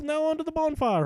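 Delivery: high, loud triumphant battle cry; rally yourself onward; heroic resolve. Acted. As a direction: hero Hrothgar charges for the bonfire, triumphant. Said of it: "Hrothgar thought".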